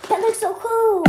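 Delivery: high and loud amazed; excited. Real, not acted. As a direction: gush with delight. Sees water turning yellow.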